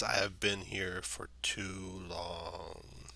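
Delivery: slow, whispered ancient tree voice; slow treant voice. Acted. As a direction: breathe it out like a weary old tree. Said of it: "speaks in a slow, slow voice".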